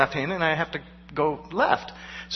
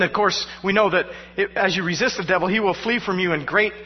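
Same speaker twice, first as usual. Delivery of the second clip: ordinary delivery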